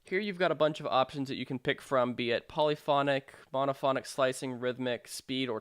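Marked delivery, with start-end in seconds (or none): none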